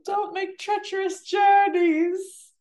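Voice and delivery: very high, loud, drawn-out mock warning; TikTok impression. Reminding the co-host of a TikTok, high-pitched mock warning. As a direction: belt it out slowly like a silly viral clip. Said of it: "What's that TikTok?".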